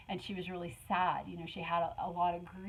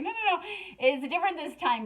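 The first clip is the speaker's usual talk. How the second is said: high-pitched, louder protest; hurried mock reassurance; 9 semitones higher, 5 dB louder than usual. Answering the panicked "Oh God", high insistent reassurance. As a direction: cut off the worry in a high voice.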